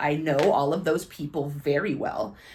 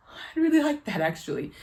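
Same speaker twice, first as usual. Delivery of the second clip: touched reply; 10 semitones higher than usual. Retold or imitated. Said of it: "I was like".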